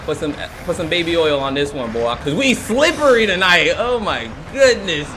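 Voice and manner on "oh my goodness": slow, drawn-out exclamation; astonished. Pretend. Gamer amazed by slippery escape, astonished. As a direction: draw out GOODNESS in amazement. First reaction, then screamed repeat.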